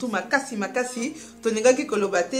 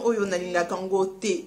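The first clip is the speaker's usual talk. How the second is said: ordinary talk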